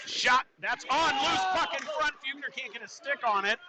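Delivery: high, urgent call of a shot; excited play-by-play. Real, not acted. Announcer calling a loose puck in front, excited. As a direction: pitch leaps up as the puck lies loose.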